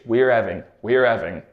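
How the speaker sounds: "We're having" with the h almost gone; dropped H. Shown as a demonstration. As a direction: glide "We're having" without the h. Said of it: "cutting it out".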